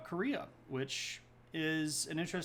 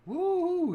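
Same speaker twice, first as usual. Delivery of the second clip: high, loud "woo" of celebration; long high-pitched cheer; excited whoop; 12 dB louder than usual. Real, not acted. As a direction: let out a big celebratory whoop. Cheering the fiftieth episode with chat.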